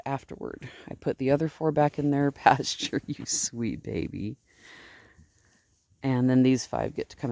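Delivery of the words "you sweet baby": soft, slow, lower coo to a lamb; cooing tenderly at the sheep. Real, not acted. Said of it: "me blethering and baby talking".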